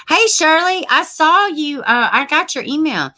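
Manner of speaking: high, loud happy shout-out; excited greeting. Real, not acted. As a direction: call out to a viewer with delight. Greeting viewer on birthday.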